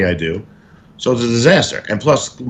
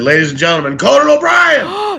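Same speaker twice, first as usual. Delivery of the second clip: MC announcement; 3 dB louder than usual. Retold or imitated. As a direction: hype the headliner, stretching out the name. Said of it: "she went up there and said".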